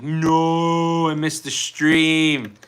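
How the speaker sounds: hammed-up, stretched-out lament; mock dismayed wail. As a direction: wail it slowly in exaggerated dismay. Acting out dismay at being late.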